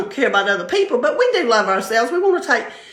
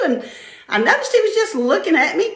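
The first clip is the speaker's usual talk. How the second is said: ordinary delivery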